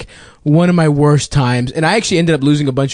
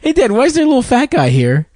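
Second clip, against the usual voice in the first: put-on higher, curious toddler asking dad; high child voice; 10 semitones higher, faster than usual. Comic voices the toddler at the gym, high and curious. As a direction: play the innocent little kid. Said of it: "He was like".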